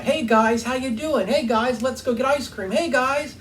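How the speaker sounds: playfully calling the guys over and over; imitating Mother D. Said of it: "that's how she would talk".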